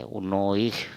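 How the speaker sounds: "उन्नीस" as "unoikh"; final s as kh. Shown as a demonstration. Teacher showing the Assamese s rule, "unoikh" for उन्नीस. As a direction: end उन्नीस on a breath-like kh, not s. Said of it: "स का उच्चारण ख होता है".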